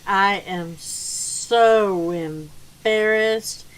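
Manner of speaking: very slow, stretched-out words; retold embarrassment. Guest owning up to her mistake, drawn-out embarrassment. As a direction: draw out each word, especially so. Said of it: "she was like".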